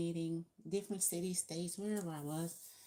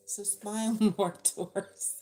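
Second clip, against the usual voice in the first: laughing through the words, louder; 4 semitones higher, 8 dB louder than usual. Real, not acted. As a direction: chuckle while urging to smile. Laughs mid-word.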